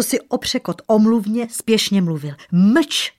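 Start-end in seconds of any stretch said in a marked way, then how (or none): 2.5-3.1 loud, sharp command; barked order. A narrator's reading. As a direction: silence them with one angry burst. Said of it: "štěkl bledý král". (ostře štěknutý rozkaz)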